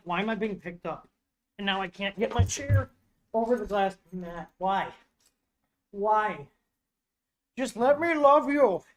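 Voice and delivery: half-hearted whiny pet voice, rising at the end; voicing the dog. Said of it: "Remo's like".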